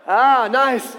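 sudden high shout; loud exclamation. Acted. David reacts to the king's armor, loud outburst. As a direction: burst out loudly on "Ah". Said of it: "David's like".

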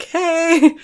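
high, drawn-out flirty hey; admiring stranger. Acted. Anyone meeting the muscular friend, admiring high hey. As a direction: coo it high, half laughing. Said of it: "is gonna be like".

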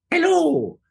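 high Mario hello. Shown as a demonstration. The mask's Mario voice answering, high. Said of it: "Mario's voice would go".

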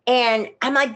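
ordinary talk, nothing marked

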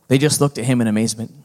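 usual speaking voice

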